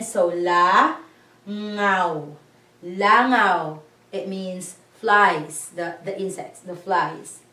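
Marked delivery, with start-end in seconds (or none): 0.0-3.8 la, then ngao with a nasal ng, then Langao joined; syllable by syllable. Shown as a demonstration. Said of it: "there's a W in the end".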